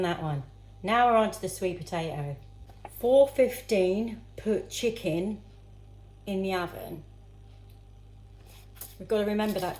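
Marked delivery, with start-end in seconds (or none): none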